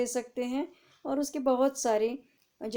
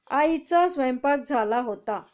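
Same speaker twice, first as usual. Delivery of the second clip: reading aloud; 3 semitones higher, 5 dB louder than usual. Shown as a demonstration. Teacher reading a tense example aloud to students. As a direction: read out clearly to the class. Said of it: "आपको पढ़ के भी दिखाती हूँ".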